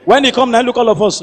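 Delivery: ordinary delivery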